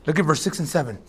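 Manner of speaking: ordinary delivery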